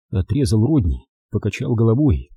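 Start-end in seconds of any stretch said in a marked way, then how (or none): none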